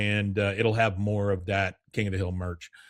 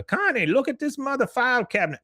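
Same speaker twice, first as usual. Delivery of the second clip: Con's voice; 13 semitones higher than usual. Retold or imitated. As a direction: shout it to Connie way up high. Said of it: "he says".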